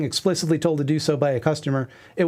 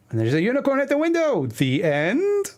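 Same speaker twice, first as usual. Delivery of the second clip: high mock reveal; 9 semitones higher than usual. Punchline of a read comic panel.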